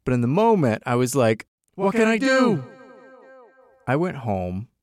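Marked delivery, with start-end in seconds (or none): 1.7-2.6 exasperated. Retold or imitated. Confused inner voice quoted.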